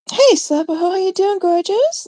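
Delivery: high, syrupy fake-sweet hello; mock sugary greeting. Vlogger mocking a rival's fake-sweet greeting, high and syrupy. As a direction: put on a sickly sweet voice to mock her.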